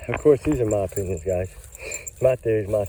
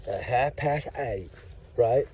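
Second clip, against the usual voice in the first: imitating her; 5 dB quieter, slower than usual. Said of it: "that's exactly how she said".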